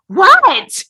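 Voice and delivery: high, loud mock "What?"; mock girlfriend. Girlfriend answering his big line, high loud mock "What?". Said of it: "she's like".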